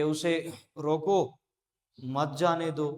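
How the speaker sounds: "रोको" drawn out slowly, then a break before "मत जाने दो"; pause after रोको. Shown as a demonstration. Teacher shows how some would read it without the mark, pausing after "रोको". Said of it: "कुछ लोग इसको ऐसे पढ़ते".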